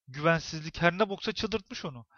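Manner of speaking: ordinary delivery, nothing marked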